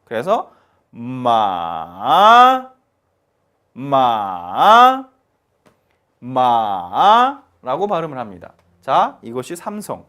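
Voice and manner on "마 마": 마 dipping low then rising; 마 falling to a low dip, then climbing again; third tone. Shown as a demonstration. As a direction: sink 마 down and bring it back up. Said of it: "깊이 내려왔다가 다시 쭉 올라가는".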